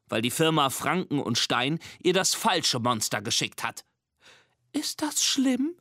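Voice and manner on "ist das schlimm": squeaky, hesitant question; timid squeaky question. Acted. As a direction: ask shyly in a tiny voice. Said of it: "fragt Mimi leise".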